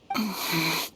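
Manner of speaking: soft smug chuckle; gloating laugh. Acted. Thug gloats that nobody will defend him, smug chuckle. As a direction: laugh at their silence.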